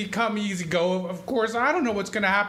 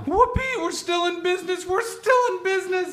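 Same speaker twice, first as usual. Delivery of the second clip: much higher, shouted delight; whooping joy; 7 semitones higher than usual. Acted. Uncle celebrating the building and loan surviving, joyful whoop. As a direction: burst out cheering at the good news. Board votes Potter down, celebration.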